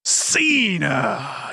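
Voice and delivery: "Cena" stretched long and high like a ring announcer; wrestling announcer call. Shown as a demonstration. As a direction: draw out "Cena" as a booming ring intro. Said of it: "You gotta say it like the announcer".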